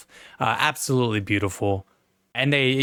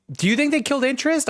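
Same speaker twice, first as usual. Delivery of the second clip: incredulous; 13 semitones higher, faster than usual. Real, not acted. Incredulous question.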